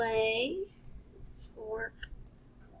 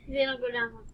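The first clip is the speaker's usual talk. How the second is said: ordinary talk, nothing marked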